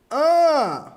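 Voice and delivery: loud high orgasm grunt; sex moan grunt. Acted. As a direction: let out a sudden high grunt. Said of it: "impression of a therapist having sex".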